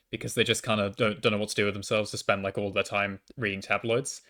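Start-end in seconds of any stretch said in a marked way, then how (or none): none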